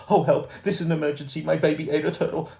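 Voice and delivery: frantic mother on the phone; panicked cry. A narrator's reading. Mum crying for help to the operator, panicked and loud.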